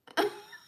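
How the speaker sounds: high laugh that catches into a sob; teary laugh. Real, not acted. Vlogger laughing while crying at kind comments. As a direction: laugh through tears. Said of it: "you guys have made me cry".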